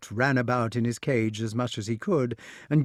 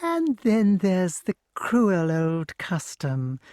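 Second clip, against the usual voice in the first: gloating witch; 8 semitones higher, much slower than usual. A narrator's reading.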